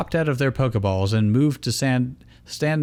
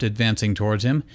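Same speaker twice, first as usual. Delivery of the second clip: usual speaking voice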